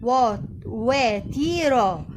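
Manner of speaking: "حبيطة" and "واتيرة" drawn out very slowly syllable by syllable; slow spelling out. Shown as a demonstration. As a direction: stretch each syllable of "واتيرة" for a child. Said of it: "تديله مثلًا الميم مفتوحة يبقى سائمة".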